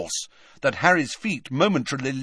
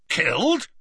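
high, rising startled question; loudly; 7 semitones higher than usual. A narrator's reading. Hagrid staring down at Harry, loudly incredulous. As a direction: pitch it up in disbelief. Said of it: "said Hagrid loudly".

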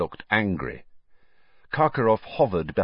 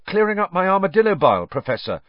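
high, loud, quick innocent reply; Harry, innocent; 11 semitones higher, 7 dB louder, faster than usual. A narrator's reading. Harry caught eavesdropping by Snape, innocent. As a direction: answer brightly as if nothing's amiss. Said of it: "said Harry innocently".

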